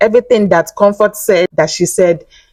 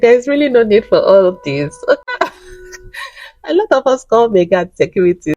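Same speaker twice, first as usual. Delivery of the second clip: amused; 5 semitones higher than usual. Retold or imitated. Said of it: "I'm like".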